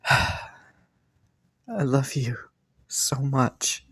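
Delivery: very soft and slow, almost breathed; slow, near-silent "I love you so much"; tender, hushed. Acted. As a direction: murmur it right into their ear. Declaring love while dancing.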